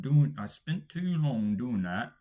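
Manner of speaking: slow Ron Weasley-like British accent. Shown as a demonstration. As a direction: stretch it out in broad British. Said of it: "you sound like Ronald Weasley".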